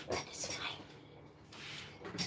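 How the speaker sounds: slow, breathy whisper; resigned whisper. Real, not acted. Vlogger whispering to herself, resigned about tea.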